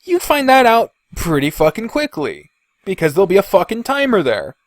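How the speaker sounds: usual speaking voice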